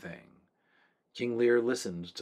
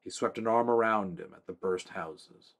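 ordinary delivery, nothing marked

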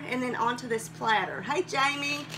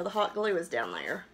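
ordinary delivery

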